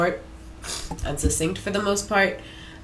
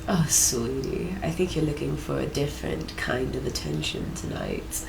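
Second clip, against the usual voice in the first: hushed sultry flirt, lower and softer; sultry seductress; 6 semitones lower, 7 dB quieter than usual. Acted. Voices seductive AI character.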